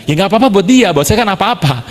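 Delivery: object, hammed-up, on behalf of a sore hand; retold exasperated protest. Preacher objects to shaking hands with his injury, high protest.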